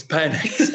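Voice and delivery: Penix said as PEN-iks; short-e pronunciation. Shown as a demonstration. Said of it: "the pronunciation of that".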